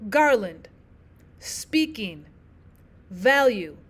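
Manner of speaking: first syllable stressed in "garland", "speaking", "value"; trochaic stress. Shown as a demonstration. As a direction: hit GAR-land, SPEAK-ing, VAL-ue, letting the second syllable fall. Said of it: "stressed syllable and then the unstressed".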